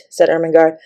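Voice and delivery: plain reading voice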